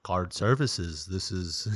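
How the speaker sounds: hammed-up American phone voice, kept low; mock American accent. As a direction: slowly imitate a fake American accent. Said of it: "doing the American accent".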